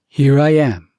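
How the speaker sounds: loud sudden call out; a loud here-I-am call. Acted. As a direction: announce yourself loudly. Stray loud interjection.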